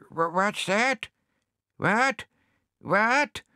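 high, puzzled repeated what; deaf old hedgehog. Acted. Deaf hedgehog straining to catch the question. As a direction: strain to hear, confused.

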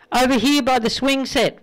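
lightly voiced drunk. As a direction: call back cheerfully drunk. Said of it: "replies the drunk".

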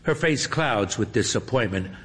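plain reading voice

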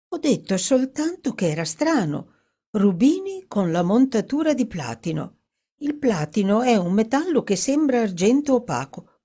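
eager, admiring character voice; Dorothy, insistent. Acted. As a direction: defend your hunch with fascination.

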